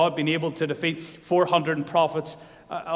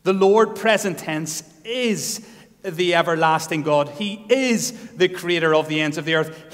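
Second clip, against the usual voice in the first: louder, ringing declaration; loud proclamation; 3 semitones higher, 8 dB louder than usual. Acted. Preacher declaring God's answer, loud. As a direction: proclaim it with full force.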